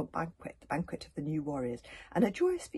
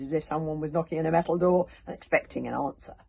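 ordinary delivery, nothing marked

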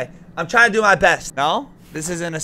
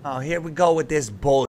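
ordinary talk, nothing marked

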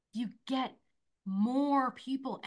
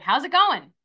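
loud, higher cheery greeting; upbeat check-in; 7 semitones higher, 13 dB louder than usual. Acted. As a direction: call out brightly to check on a client. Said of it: "I'll reach out and be like".

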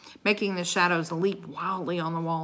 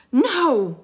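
high, loud protest; cried out; 9 semitones higher, 11 dB louder than usual. A narrator's reading. Enchantress objects to the children going, cried out. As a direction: cry out to stop them. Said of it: "cried Morgan Le Fay".

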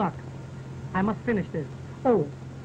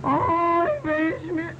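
comic moaning cry; 4 semitones higher, much slower than usual. Retold or imitated. Stranger on the train moaning to himself, comic wailing cry. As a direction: moan it out like a man lost and miserable on a train. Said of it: "kept moaning to himself like this".

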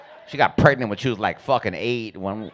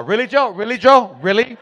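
high, louder incredulous questions; wife scolding her husband in rising disbelief; mom's exasperation; 7 semitones higher, 5 dB louder than usual. Retold or imitated. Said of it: "then she would always say".

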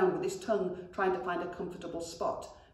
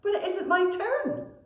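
much higher, louder protest; squawking protest; 14 semitones higher, 7 dB louder than usual. A narrator's reading. Goblin protests to brother, high and squawky. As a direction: wail that it isn't fair. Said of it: "squawked Saggy Pant".

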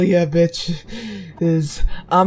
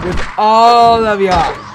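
louder, harsher "With all of ya"; menacing growl-shout; 2 semitones higher, 8 dB louder than usual. Real, not acted. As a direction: bark it with menace.

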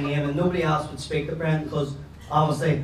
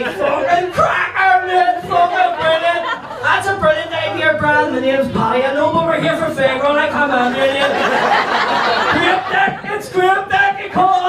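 mock mates cheering; 12 semitones higher, 8 dB louder than usual. The mates high-fiving him and cheering, loud and high. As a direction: shout it like cheering drunk mates. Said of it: "The boys were high-fiving me".

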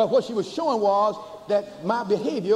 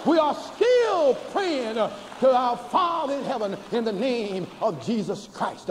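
ordinary talk, nothing marked

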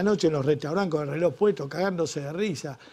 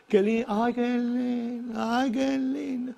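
mock swooning; 7 semitones higher, slower than usual. Swooning over Miami like Rockefeller.

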